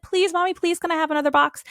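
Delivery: put-on high, quick begging kid; pleading child. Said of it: "approaching it with so much enthusiasm".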